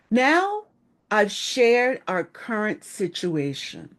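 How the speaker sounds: a lifted Now, then a slow, measured line; inviting pause. Shown as a demonstration. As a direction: open the floor with a calm, slow lead-in. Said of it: "you'll say something like".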